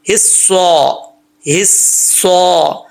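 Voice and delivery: slow हिस्सा with thick, rounded saw; heavy saad. Shown as a demonstration. Teacher shows saad, emphatic careful recitation. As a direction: hold the double स of हिस्सा full-mouthed. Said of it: "स्वाद को स्वाद ही पढ़ना है".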